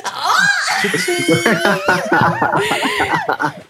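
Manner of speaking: high, squealing burst of laughter; real squeal into giggles; shrieking laughter. Real, not acted. Cracking up at wish list joke.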